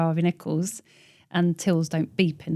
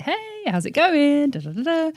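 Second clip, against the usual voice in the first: breezy casual greeting; 10 semitones higher, 3 dB louder than usual. Shown as a demonstration. Showing her casual email tone, bright and sing-song. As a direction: toss it off cheerily. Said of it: "I just mean, like".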